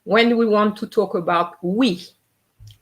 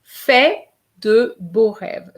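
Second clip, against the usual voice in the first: slow careful model; 2 semitones higher, 4 dB louder, slower than usual. Shown as a demonstration. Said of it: "a little bit longer".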